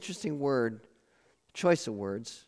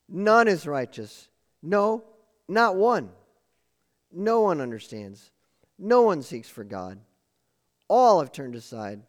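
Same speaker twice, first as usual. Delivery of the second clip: emphatic scripture reading; 3 semitones higher, 4 dB louder than usual. Acted. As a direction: hammer home every none. Reads Psalm quotes emphatically.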